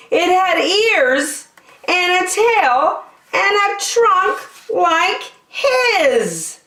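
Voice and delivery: high, very slow amazed reveal; wonder. A narrator's reading. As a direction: marvel at the baby. Wonder at the elephant-bird.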